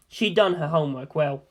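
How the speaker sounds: ordinary delivery, nothing marked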